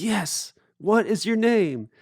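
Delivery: high, slow put-on voice; imitated insistent question. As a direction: spell out the question, retold. Edwin still calling to boatman.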